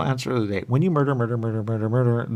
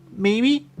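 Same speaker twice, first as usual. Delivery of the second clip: mock-unsure reply; 6 dB louder than usual. His own reply, retold.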